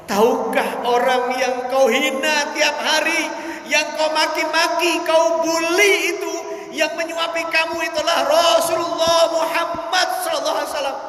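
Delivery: tearful rebuke. Acted. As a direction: let the voice climb and break as he accuses. Abu Bakar crying, rebuking the beggar.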